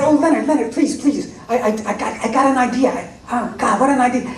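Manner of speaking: bursting with an idea, begging to be heard; excited pleading. Retold or imitated.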